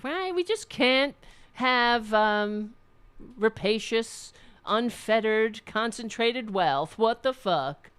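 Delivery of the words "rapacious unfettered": ordinary talk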